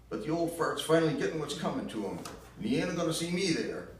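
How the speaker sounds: put-on high, loud bitter accusation in a southern accent; bitter southern mother. Ma blaming Gramps for Gran's death, loud southern bitterness. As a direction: spit the blame out in a shrill Southern voice. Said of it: "that nasty tune of hers".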